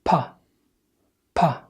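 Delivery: "با" said as an aspirated pa, not Arabic ba; isolated "با" with unvoiced English p; English P sound. Shown as a demonstration. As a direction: puff a short p on "با". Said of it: "دي مش صوت ال ب بالعربي".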